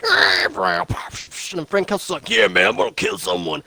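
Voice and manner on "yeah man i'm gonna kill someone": a hint of a gruff tough guy; lightly voiced Punisher. Friend retelling the movie, lightly voicing the Punisher. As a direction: toss off a casual threat in a put-on voice. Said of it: "why does he sound like that".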